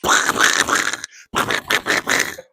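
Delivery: put-on wordless sputtering noise as a Florida accent; mock Florida accent. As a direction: blow a long unvoiced sputter to mock Florida speech. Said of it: "the Florida accent is just".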